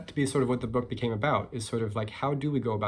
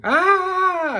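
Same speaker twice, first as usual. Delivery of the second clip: piercingly high, loud scream; 12 dB louder than usual. Acted. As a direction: keep screaming as the panicked turtle. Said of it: "the turtle is still screaming".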